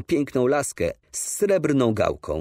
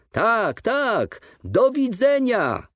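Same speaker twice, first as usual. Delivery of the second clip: repeated farewell cry; 11 semitones higher, much slower than usual. A narrator's reading. Father repeats his goodbye as the train pulls away, crying out. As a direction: cry the goodbye after the departing train, stretched out. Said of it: "powtórzył mój ojciec".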